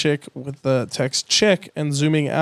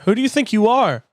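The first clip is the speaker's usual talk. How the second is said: Sally tells off McQueen, pitched up; angry Sally; 8 semitones higher than usual. Acted. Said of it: "Sally Carrera".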